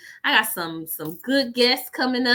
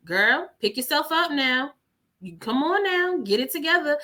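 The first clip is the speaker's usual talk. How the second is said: imitated no-nonsense mentor, pitched higher; no-nonsense pep talk; 6 semitones higher than usual. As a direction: scold like a loving coach, imitating her. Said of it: "she don't play no games".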